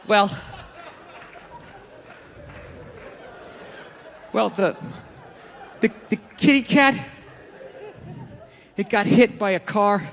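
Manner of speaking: halting, stammered reluctance with long pauses; hesitant bad news. Lady telling the gorilla its cat died, haltingly. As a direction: put off breaking it, word by word.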